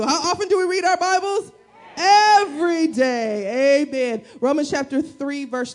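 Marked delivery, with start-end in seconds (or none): 0.0-1.5 lifted, laughing call-out, said like a declaration; bold declaration. Shown as a demonstration. Pastor to congregation, turning the question into a laughing declaration. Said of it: "Let's say it as a declaration". 2.0-3.8 slow, louder drawn-out answer; declared. Shown as a demonstration. Pastor to congregation, modeling the answer as a slow loud declaration. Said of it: "Let's say it as a declaration".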